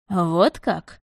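stretched, skeptical drawl; drawn-out drawl. A narrator's reading. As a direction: drag it out, squinting. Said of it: "протянул Эрик".

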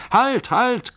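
high, loud cry to stop; calling out. A narrator's reading. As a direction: shout to halt the dancers.

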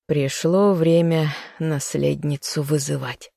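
put-on slow, tired old woman; weary sigh. A narrator's reading. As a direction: let it sigh out, spent. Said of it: "вздохнула Анна".

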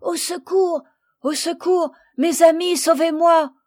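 high, loud cries for help; calling for help. A narrator's reading. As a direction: cry out desperately to be rescued. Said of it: "C'était Sophie qui appelait".

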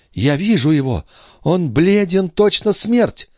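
high woman's voice, climbing; entranced sister, fearful. Acted. Sister describes the pale intruder to brother, high and fearful. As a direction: let the pitch climb as the vision sharpens.

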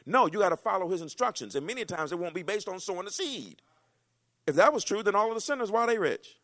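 high and loud preacher climax; fiery preaching. Acted. Preacher rebuking the church at full force. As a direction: hammer it at the congregation.

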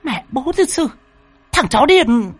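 high falsetto insults; high-voiced angry woman swearing; shrill cursing. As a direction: hurl curses after someone fleeing. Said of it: "nó chửi xa xả".

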